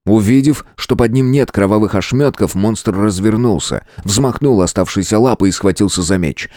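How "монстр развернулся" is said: ordinary delivery, nothing marked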